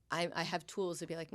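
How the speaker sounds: usual speaking voice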